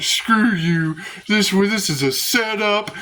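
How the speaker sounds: mildly indignant mock outburst; retold anger. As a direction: imitate someone mad and defensive. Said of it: "And then got mad".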